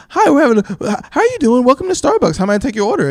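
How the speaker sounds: high, sugary mock-friendly service voice; polite barista voice. Mocking how Starbucks staff greet customers, high and sugary. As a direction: chirp it like a Starbucks cashier. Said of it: "hear them talk when you go to Starbucks".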